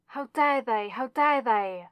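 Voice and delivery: building fury, hammering each repeat; outraged. Acted. Enraged outburst over hurt loved one.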